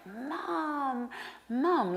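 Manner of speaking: high, half-sobbed call for mother; a little girl calling out to mom; crying for mom. Retold or imitated. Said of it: "I was crying like".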